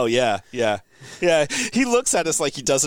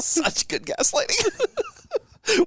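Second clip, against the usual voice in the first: slow laughing words; laughing at own trick; much slower than usual. Real, not acted. Laughing drawn out.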